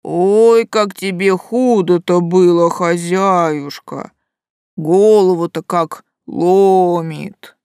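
drawn-out pained creature voice; slow, aching moan; pained groaning. Acted. Creature feels her headache.

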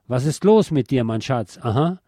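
usual speaking voice

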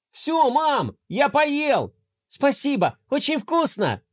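put-on high child calling out; cheerful boy. Boy Roma calling to his mum from afar, cheerful. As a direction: call it out as a happy kid.

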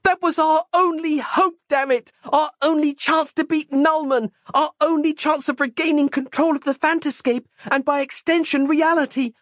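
high-pitched furious tirade; frustrated outburst. A narrator's reading. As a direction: rail at him, voice held very high. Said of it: "no longer able to contain her frustration".

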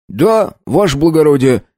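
high, deferential peasant voice; a servant quick to obey; eager coachman. A narrator's reading. Coachman answers his master. (услужливый мужик)

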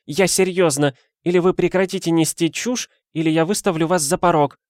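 high-pitched firm ultimatum; curt madame. A narrator's reading. Madame threatening to throw out the flatterer, curt. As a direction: put your foot down, pitched high. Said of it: "отрезала женщина".

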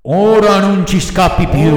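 threaten, then repeat fainter and fainter; menacing threat. A narrator's reading. Assassins seize Pinocchio by the neck, echoing menace. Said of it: "gli brontolarono minacciosamente".

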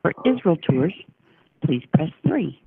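ordinary talk

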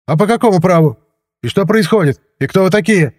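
high-pitched agitated questioning; indignant. A narrator's reading. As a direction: challenge their authority, worked up. Agitated director challenges the officers. (возмущённо, высоким голосом)

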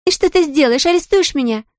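high, goading challenge; taunting. Acted. Blonde to the cop daughter, high and taunting. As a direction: push her, mocking and high. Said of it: "стала наседать на неё Сэнди".

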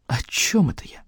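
whispered aside. A narrator's reading. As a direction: whisper it to yourself. Narrator aside to self.

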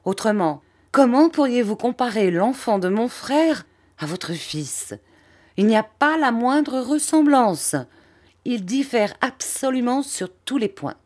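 high-pitched, outraged protest; indignant tirade. A narrator's reading. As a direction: rail against the comparison, affronted. Angry woman rejects the comparison. (tirade indignée, voix haut perchée)

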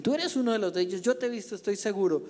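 fast, high, pressing accusation; insistent accusation. Acted. A bystander accuses Peter, fast and high. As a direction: corner him with certainty, quickly.

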